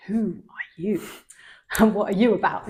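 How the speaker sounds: whispering, baffled; whispered bewilderment. Retold or imitated. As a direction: breathe it out in disbelief. Bewildered teen reacting to stranger.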